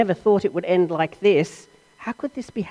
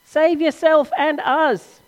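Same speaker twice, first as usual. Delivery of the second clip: slow, high, loud taunting shout; jeering shout; 9 semitones higher, 5 dB louder, slower than usual. Acted. First criminal jeering at Jesus from cross, loud slow shout. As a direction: fling the taunt out slowly and high. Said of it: "This shout interrupts".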